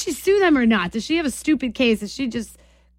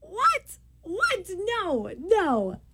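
high, incredulous protest dropping to softer refusal; real disbelief; 9 semitones higher, 6 dB quieter, much slower than usual. Real, not acted. Host hearing a caller pick the giant slide, incredulous. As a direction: balk at the answer, pitch leaping on the first word. Rejects caller's unexpected date ride.

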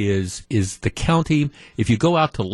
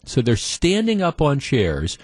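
ordinary delivery

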